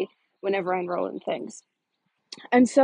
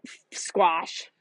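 mock dismissive; 6 semitones lower than usual. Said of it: "And then I was like".

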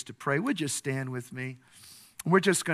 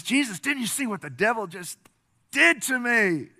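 high-pitched outraged protest, rising louder; indignant complaint; 9 semitones higher, 6 dB louder than usual. Acted. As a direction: demand that your guest take notice. Dreamer protests after devil's attack.

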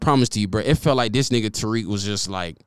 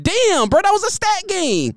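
much higher, whiny regret; imitating Waddle. Waddle regretting missing the stat game, high whine. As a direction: pout like a player who missed a big game. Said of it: "I know Waddle mad 'cause I know he like".

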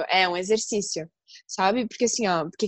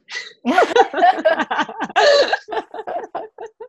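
high shrill cackling laugh; witch cackle; 12 semitones higher, 4 dB louder than usual. Real, not acted. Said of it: "Ai, risada de bruxa ainda".

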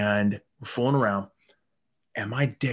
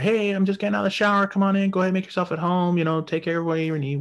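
high, louder and quicker woman's voice; chirpy woman; 9 semitones higher, 4 dB louder, faster than usual. Retold or imitated. Woman calling from the shower to the guys at the door, high chirpy voice. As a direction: chirp it like a bubbly hostess. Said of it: "she's like".

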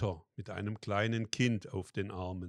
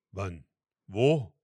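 astonished; 8 dB louder than usual. A narrator's reading. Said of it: "fragte Martin erstaunt".

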